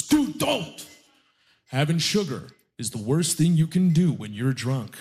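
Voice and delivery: a hint of a preachy gym friend; lightly voiced scolding health nut. Drunk friend warns against sugar.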